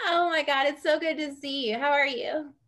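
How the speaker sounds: loud, high, delighted hello; excited greeting. Real, not acted. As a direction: greet a friend with real excitement. Greeting a friend who arrived late.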